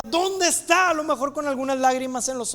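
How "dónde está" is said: high, commanding question; with authority. Acted. Jesus asks where they laid Lázaro, commanding voice. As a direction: ring it out as an authoritative demand. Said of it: "esta voz de Jesús diciéndolo con autoridad".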